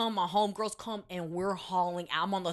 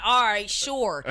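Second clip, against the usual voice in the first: sarcastic agreement; 8 dB louder, slower than usual. Retold or imitated. Sarcastic reply to boyfriend.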